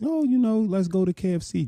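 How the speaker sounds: way up high, sweet and bubbly; mock girlfriend voice, high pitched; high girly voice. Imitating girlfriend offering treat.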